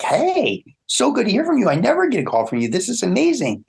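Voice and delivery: high, bright-eyed, gushing hello; thrilled friend picking up the phone; delighted phone greeting. Retold or imitated. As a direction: gush with joy at the call, high and quick. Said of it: "This is me on the phone".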